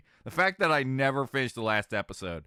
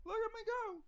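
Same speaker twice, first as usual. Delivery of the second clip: put-on kid voice. Child showing off flexibility, very high kid voice. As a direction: squeal like a proud little kid. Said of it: "you're always just like".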